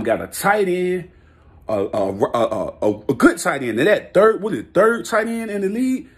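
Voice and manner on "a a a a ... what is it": usual speaking voice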